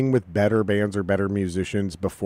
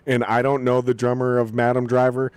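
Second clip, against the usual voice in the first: normal talking voice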